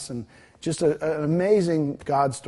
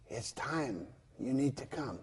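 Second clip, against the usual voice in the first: soft gentle plea; 12 dB quieter, slower than usual. Retold or imitated. Little Kelly reaching out her hand.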